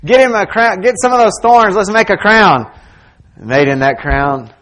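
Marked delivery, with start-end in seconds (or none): 0.0-2.7 loud, high-pitched jeering; mocking soldiers. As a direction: taunt him like a rowdy soldier. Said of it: "mocking Him said".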